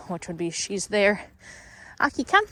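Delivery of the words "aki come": bright high-pitched call to the dog, drawn out slowly; high happy call. Real, not acted. Trainer calling her dog back, high and loud. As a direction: sing out her name and praise her.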